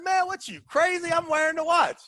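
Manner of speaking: high-pitched incredulous comeback; retold indignant refusal. Arguing with Kaplan about the watch, indignantly. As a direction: push back in an indignant high voice.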